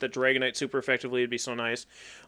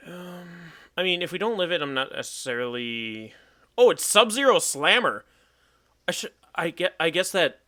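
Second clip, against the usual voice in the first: usual speaking voice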